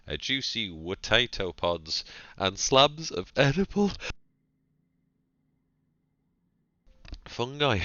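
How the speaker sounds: holding back a sneeze; about to sneeze. Real, not acted. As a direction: read through a coming sneeze. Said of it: "I'm going to sneeze".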